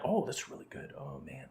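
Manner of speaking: hushed, savoring mock murmur; soft ASMR murmur. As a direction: murmur as if tasting food. Imitating mukbang eaters savoring food.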